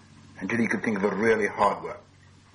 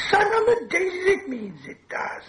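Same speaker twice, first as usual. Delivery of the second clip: very high, slow creature whine; thin piping croon, drawn out at the end; Gollum creature voice. A narrator's reading. Gollum answering from old memories, high creature whine.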